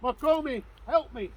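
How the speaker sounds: high, loud pleading cry; desperate call for help. Acted. Widow running to Macomie for help, loud desperate plea. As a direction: cry out to him for rescue.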